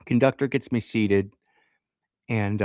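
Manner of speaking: ordinary delivery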